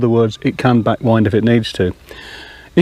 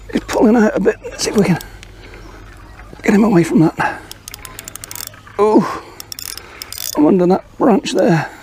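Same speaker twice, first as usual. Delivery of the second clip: fighting a fish; 5 semitones higher than usual. Real, not acted. Playing a snagged fish, straining.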